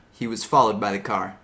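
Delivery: ordinary delivery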